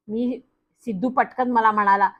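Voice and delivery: ordinary delivery, nothing marked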